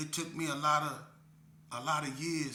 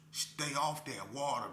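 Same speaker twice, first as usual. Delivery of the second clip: ordinary talk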